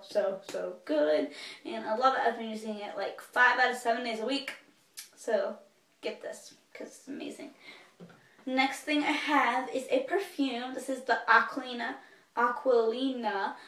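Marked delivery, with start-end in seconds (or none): 6.0-7.5 whisper. Real, not acted. Urging viewers to buy.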